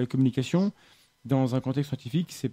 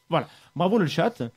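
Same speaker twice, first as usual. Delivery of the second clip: sarcastic praise; 5 semitones higher, 6 dB louder than usual. Pretend. Mock praise for a silent chat.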